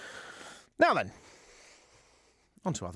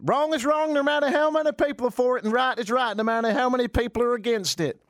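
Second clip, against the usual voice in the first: mock preacher; 10 semitones higher than usual. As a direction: ham up the pastor's slogan. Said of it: "That last statement".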